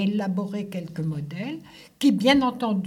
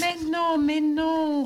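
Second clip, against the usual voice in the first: higher-pitched, drawn-out protest; reassuring retort; 6 semitones higher, slower than usual. A narrator's reading. Milliner brushing off the apprentice's doubts, reassuring retort. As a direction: wave the worry away in a higher voice. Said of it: "rétorqua madame Joséphine".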